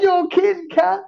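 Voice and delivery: high, loud, laughing disbelief; a high, loud mocking retelling of the mate's shock; mock shocked outburst. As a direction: squeal it out half laughing, in mock outrage. Brucey reacting to the bar bill.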